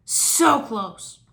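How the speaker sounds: frustrated villain. Acted.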